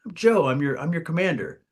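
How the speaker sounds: high, loud, breezy officer greeting; chummy officer voice. Retold or imitated. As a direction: play the overly casual commander. Commander voiced in army story.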